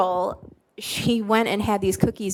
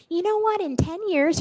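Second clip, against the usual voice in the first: mom's voice, pitched much higher; imitated mom; 10 semitones higher than usual. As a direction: mimic a mother cutting off complaints, high-pitched. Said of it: "she's like".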